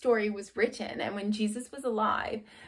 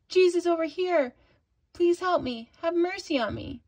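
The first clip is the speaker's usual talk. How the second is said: higher, louder call over a crowd; desperate call for help, raised and high; shouting louder; 6 semitones higher than usual. Acted. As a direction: plead loudly to be heard. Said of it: "shouted louder".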